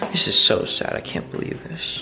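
whispered disappointment; sad whisper. Real, not acted. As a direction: breathe it out, let down. Sad after failing to get addresses.

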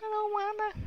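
put-on high, soft childish whimper; high small whine. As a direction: whimper it like a scared little kid. Whining at the game.

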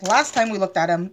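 ordinary delivery

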